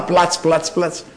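soft imitated splash of a foot stamping in water; splashing sound effect. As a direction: whisper the splash, plats plats plats. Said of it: "Χτύπαγα το πόδι μου δυνατά".

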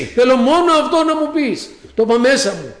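ordinary delivery

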